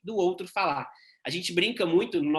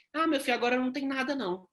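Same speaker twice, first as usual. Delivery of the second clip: put-on old man voice; 5 semitones higher, faster than usual. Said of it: "seu Joaquim replying".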